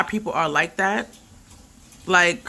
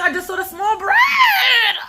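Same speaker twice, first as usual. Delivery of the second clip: much higher, slow laughing shout; surprised outcry; 12 semitones higher, much slower than usual. Real, not acted. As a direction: caught out by the bread, yelping and laughing. Finds the small bread, cries out.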